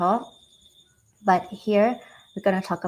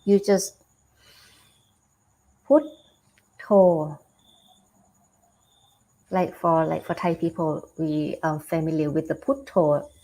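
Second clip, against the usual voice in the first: normal talking voice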